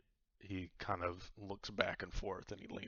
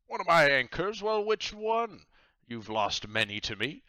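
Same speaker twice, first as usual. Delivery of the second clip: high, loud jovial pirate captain; 9 semitones higher, 10 dB louder than usual. Acted. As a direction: boom it out like a cheery pirate host. Captain Myers voice.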